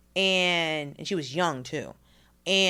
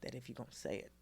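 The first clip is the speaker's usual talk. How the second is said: teacher's quiet word; 7 semitones lower, 15 dB quieter than usual. Retold or imitated. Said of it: "She's like".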